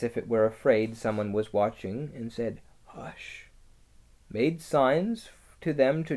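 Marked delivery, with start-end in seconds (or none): 2.9-3.5 very soft, voiceless whisper; barely audible whispered warning; furtive hushing. A narrator's reading. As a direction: hush them as if afraid of watchers. Furtive animal hushes the children.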